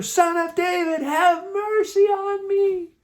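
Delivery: very high, slow all-out yell. A narrator's reading. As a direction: scream the plea, dragging out the words. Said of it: "shouting and shouting louder".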